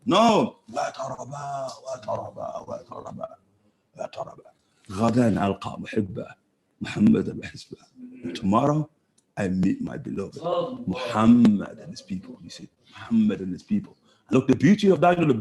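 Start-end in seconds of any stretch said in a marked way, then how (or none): 0.7-4.4 much softer, near whisper; hushed dying words. Retold or imitated. Bilal on his deathbed answering his wife, hushed. 4.9-13.9 tender recitation. Retold or imitated. Bilal longing to meet his beloved, tender and soft.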